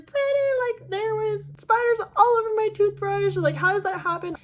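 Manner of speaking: high, loud distressed pleading; upset. Retold or imitated. Calling brother Brandon about the spiders, high upset voice. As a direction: spill it out to your brother in a fright. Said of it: "I was just like".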